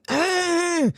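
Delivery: high imitated baby gargle; gargling cry. Swim teacher copying the baby rolling over, high gargled cry. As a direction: mimic a baby's cry through a little water. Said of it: "so it's gargling".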